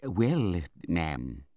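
lightly voiced mild, hesitant man; meek doctor. A narrator's reading. Doctor reports back to the aunt, meek. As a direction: begin meekly and deferentially. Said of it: "meekest manner".

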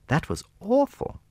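very high, dismayed lady's voice; appalled princess. A narrator's reading. Little princess condemns Napoleon's killings, appalled. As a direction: exclaim it in high-pitched horror.

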